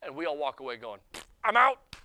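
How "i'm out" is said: loud, high mock exit line; giving up. Crowd walks away from an impossible standard, loud mock quitting. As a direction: blurt it out, bailing instantly.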